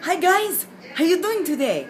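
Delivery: high, bright sing-song hello; bubbly greeting. Real, not acted. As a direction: greet the viewers with a big lift.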